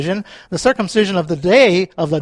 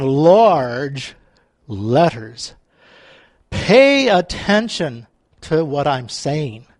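drawn-out, weighty words; deliberate and very slow; slow emphasis; 3 semitones lower, much slower than usual. Acted. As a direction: spell out the importance, word by word. Voices Paul's emphatic large letters.

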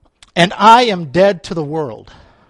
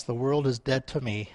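ordinary talk, nothing marked

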